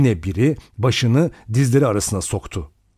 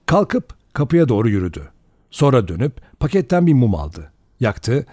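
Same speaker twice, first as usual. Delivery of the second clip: plain reading voice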